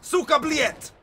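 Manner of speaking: high, loud fake Russian swearing; mock Russian accent. Gamer proving he can speak Russian, mock Russian accent shout. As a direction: yell it like an angry Russian. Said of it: "that's Russian".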